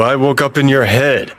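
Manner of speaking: ordinary talk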